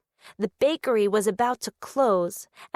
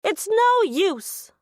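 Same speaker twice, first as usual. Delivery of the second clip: defeated; 7 semitones higher, slower than usual. Acted. Penelope gives up pushing.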